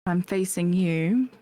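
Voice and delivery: put-on accented character voice, measured; put-on accent with long pauses; [name]. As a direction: weigh each choice in the character's accent. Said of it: "I didn't do the accent".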